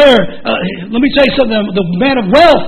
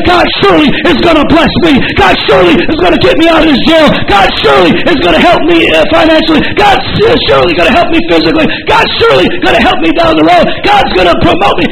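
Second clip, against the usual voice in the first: fervent shouted preaching; preaching at full force; 5 semitones higher than usual. Acted. Preacher rallying the congregation to keep faith, fervent shouting. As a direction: preach it hard, rising.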